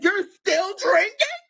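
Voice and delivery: high, loud, drawn-out mock shriek; outraged disbelief. Girlfriend catching him drinking before rehab, shrieking in disbelief. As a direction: shriek the question as if appalled. Retold shock at his drink run.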